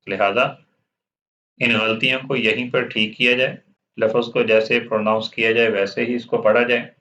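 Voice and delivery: ordinary talk, nothing marked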